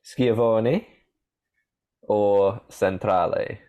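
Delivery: Italian names, carefully voiced with an Italian lilt; Italian-accented quiz options; best Italian accent. Shown as a demonstration. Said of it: "do it in my best Italian accent".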